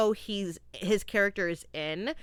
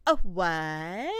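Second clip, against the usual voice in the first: feminine "a what"; 4 semitones lower than usual. Retold or imitated. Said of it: "a very feminine".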